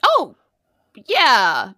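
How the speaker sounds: ordinary talk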